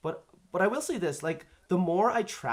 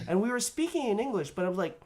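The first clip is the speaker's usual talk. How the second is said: ordinary delivery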